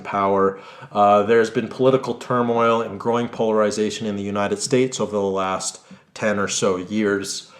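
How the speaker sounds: news anchor read. Acted. Anchor reports back to viewers after the interview, somber and measured. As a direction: sign off the story like a solemn anchor.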